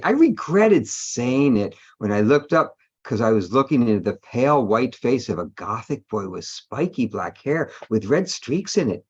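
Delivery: ordinary talk, nothing marked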